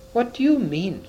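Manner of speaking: high-pitched, mildly alarmed question; understated storybook cry of fright. A narrator's reading.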